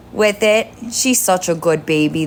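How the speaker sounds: ordinary talk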